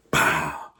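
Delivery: a loud, sharp smack sound; loud mock slap noise; imitated smack. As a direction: slap the word out like a hit.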